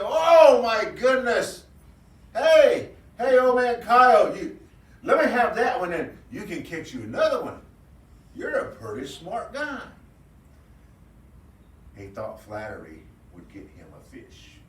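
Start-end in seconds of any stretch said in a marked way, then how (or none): 0.0-9.8 high, loud rabbit calling out with a laugh; butter up the old coyote across the pond; flattering rabbit. Acted. Rabbit calls to Coyote across the pond, high loud wheedling laugh.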